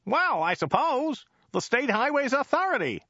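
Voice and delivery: high, slightly baffled driver; high-pitched, uncertain reply; a bit flummoxed. A narrator's reading. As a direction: answer high and mildly puzzled. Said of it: "a bit flummoxed".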